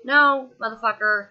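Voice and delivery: loud, sharp angry comeback; angry retort. Real, not acted. Firing back at the doctor who called her fainting a nap, angry. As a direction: snap back at him, furious.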